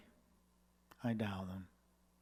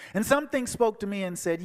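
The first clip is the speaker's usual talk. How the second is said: normal talking voice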